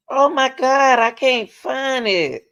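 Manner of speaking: loud, worked-up frustration; exasperated. Real, not acted. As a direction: wail it out, getting frantic at the search. Frantically searching for the news report.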